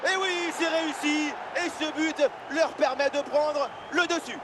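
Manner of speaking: high, bright goal call; excited sports commentary. Acted. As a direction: cheer the goal home. Goal scored after one-on-one. (annonce de but joyeuse et aiguë)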